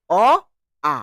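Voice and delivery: অ then আ said in turn, each held; vowel demo. Shown as a demonstration. Teacher showing the tongue dropping from অ to আ. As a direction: contrast অ and আ. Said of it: "then করবে আ".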